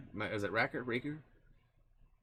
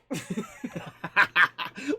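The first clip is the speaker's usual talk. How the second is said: real loud, high laugh; high burst of laughter; 11 semitones higher, 10 dB louder than usual. Real, not acted. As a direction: crack up at your own bit. Laughing at own impression.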